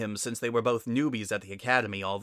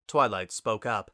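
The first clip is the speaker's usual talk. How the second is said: ordinary delivery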